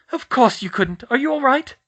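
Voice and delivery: high, loud anxious mum voice; worried mother. Acted. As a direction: fuss over him in alarm. Worried mother checks sons.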